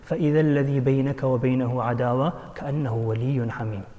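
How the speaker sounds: soft, fast recited Arabic verse; Quran recitation. Acted. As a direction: recite it gently and quickly, as scripture.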